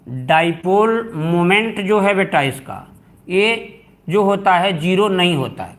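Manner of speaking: usual speaking voice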